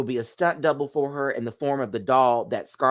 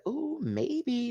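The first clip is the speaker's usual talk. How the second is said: mock hopeful; 13 semitones higher, 6 dB quieter than usual. Acts out wished-for hopeful reaction.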